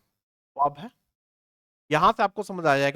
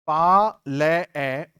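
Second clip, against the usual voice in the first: very slow, drawn-out "पाले-"; drawn-out syllable; 4 semitones lower, 4 dB louder, much slower than usual. Shown as a demonstration. Teacher slowly breaks the word into syllables. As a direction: stretch the first syllable slowly. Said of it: "सही phonetic transcription होता है।".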